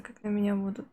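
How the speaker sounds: ordinary delivery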